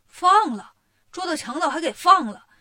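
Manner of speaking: loud, high outraged shout; "You let them go?!" roared in disbelief. Acted. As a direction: bellow it like a stunned magistrate who can't believe his ears. Magistrate hears robbers were freed.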